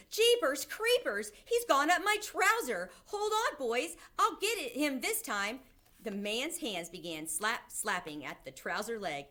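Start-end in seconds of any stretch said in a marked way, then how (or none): 0.1-5.6 high, loud alarmed shouting, easing at the end; cook shouting. A narrator's reading. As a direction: holler to the other cooks in alarm. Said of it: "the cook shouted".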